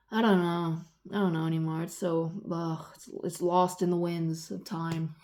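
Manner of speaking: normal talking voice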